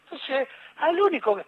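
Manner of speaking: normal talking voice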